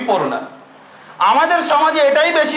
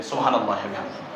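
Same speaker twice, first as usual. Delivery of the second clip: much lower and softer, fast murmur; murmured praise; 8 dB quieter, much faster than usual. Real, not acted. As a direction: breathe the praise under the voice, quickly. Murmured praise after hadith.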